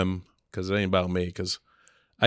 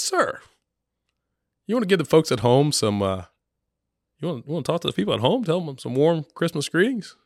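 high, bright-pitched TV reporter voice; mock TV host pitch; 8 semitones higher than usual. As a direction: play the chirpy news reporter.

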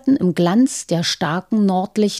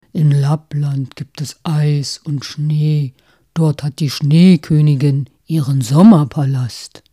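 reindeer voice; 5 semitones lower than usual. Acted. Reindeer answers in story.

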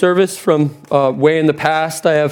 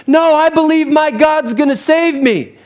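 high, insistent shouted-up refusal; calling back; 11 semitones higher than usual. Acted. Man on roof refusing a helicopter rescue, calling back high. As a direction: call it up to the helicopter, pitched high.

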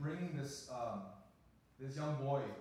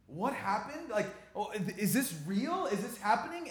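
high, louder stunned questions; shocked disbelief; 7 semitones higher, 8 dB louder than usual. Retold or imitated. Pastor hearing of the murder, shocked. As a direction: reel from the news.

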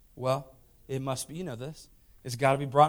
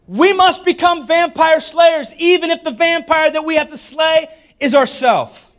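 high, loud, drawn-out rallying cry; full-force preaching; 13 semitones higher, 11 dB louder, slower than usual. Real, not acted. Preacher urging the church to slay the vampire, full-force preaching. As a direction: proclaim it loudly to the whole room.

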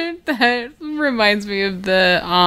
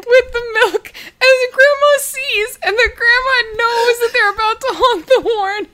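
laughing; 13 semitones higher than usual. Real, not acted. Laughing while retelling favorite Vine.

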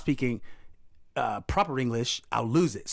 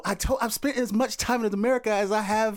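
American accent; 8 semitones higher than usual. Shown as a demonstration. British actor proving he can sound American, American accent. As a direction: show off a convincing American accent. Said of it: "You really killed that".

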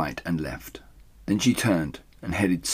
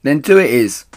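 loud, high answer; calling; 7 semitones higher, 10 dB louder than usual. Acted. Mabel answers up the stairs, loud and high. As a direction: settle it aloud.

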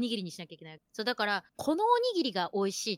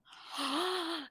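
awed sigh; 6 semitones higher, 6 dB quieter, much slower than usual. Retold or imitated. Mouth agape at lavish Ōoku.

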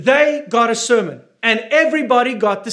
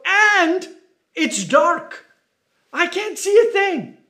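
mock panic; 7 semitones higher, slower than usual. Believer panicking in the dark storm.

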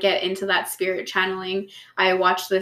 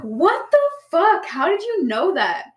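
high, slow, drawn-out shocked disbelief; stunned disbelief; 8 semitones higher, slower than usual. Retold or imitated. Stunned that her partner knew about the bone, slow high disbelief. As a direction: gasp it out, floored by what he knew.

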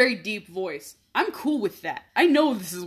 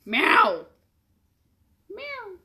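meowing; 11 semitones higher than usual. Pretend. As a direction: meow up high like a kitten. Cat imitation at Sally.

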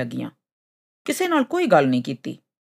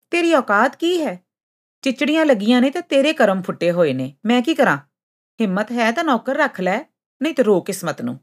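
high-pitched contemptuous challenge; angry; 6 semitones higher, 3 dB louder than usual. A narrator's reading. Sund insults the camel asking for help, angry. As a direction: put them in their place, indignant. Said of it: "ਗੁੱਸੈਲੀ ਸੁੰਡ ਬੋਲੀ".

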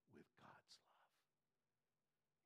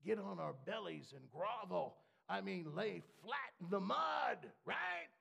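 rising shout; 4 semitones higher, 10 dB louder than usual. Real, not acted. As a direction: build from loud to a shout. Building mock demand to grovel.

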